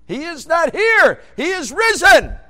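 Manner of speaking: high, slow, shouted proclamation; proclaiming. Acted. Preacher shouting the resurrection news to congregation. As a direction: cry it out to the whole church.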